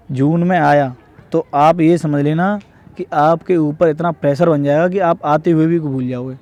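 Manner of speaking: ordinary delivery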